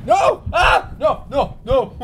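high, loud panicked protest; screaming no. Real, not acted. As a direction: shriek it in real alarm. Hot sauce poured, protesting.

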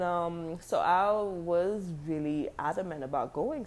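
ordinary talk, nothing marked

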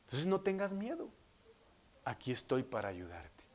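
hushed after the climax, sinking low; quiet, tender fatherly close; gentle reassurance. Acted. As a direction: drop to a soft, comforting promise. Preacher voices God, after the climax.

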